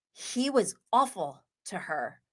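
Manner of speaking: ordinary talk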